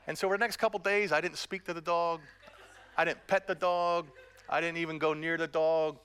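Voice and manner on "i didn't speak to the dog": usual speaking voice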